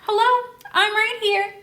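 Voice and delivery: high, sweet little alien voice; polite alien. Acted. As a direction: pipe up politely to be noticed. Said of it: "he should be like".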